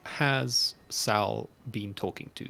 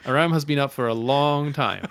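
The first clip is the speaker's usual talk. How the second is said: laughing; 5 semitones higher, 10 dB louder than usual. Real, not acted. Laughing at partner's slip.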